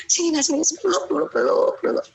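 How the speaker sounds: put-on a mother inviting her child to hug, lightly high; coaxing hug call.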